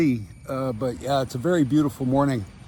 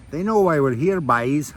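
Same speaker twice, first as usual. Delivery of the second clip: imitated Irish accent, "boys" turned into "byes"; terrible Irish accent. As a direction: do a rough Irish accent, rhyming "boys" with "eyes". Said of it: "I was gonna spare your accent".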